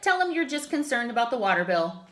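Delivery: ordinary delivery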